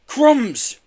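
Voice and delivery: loud, high startled roar. A narrator's reading. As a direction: shout it out in alarm. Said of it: "roared Mister Kelly".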